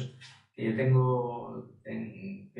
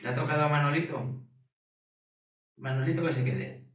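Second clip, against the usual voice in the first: bargaining volunteer; 3 semitones higher than usual. Retold or imitated. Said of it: "se presentaban a alguna familia con dinero y decían".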